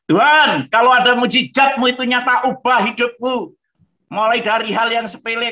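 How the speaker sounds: high, loud, urgent prayer to God; loud pleading prayer. Retold or imitated. Man recounting his conversion prayer to God, loud and high. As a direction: cry out to God, begging to be changed.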